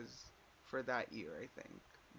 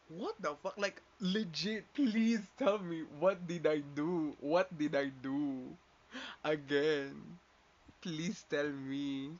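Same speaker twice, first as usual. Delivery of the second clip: louder, slow, drawn-out pleading; exasperated plea; 2 semitones higher, 6 dB louder, slower than usual. Retold or imitated. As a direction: beg them to explain, half mocking. Retold desperate plea to friends.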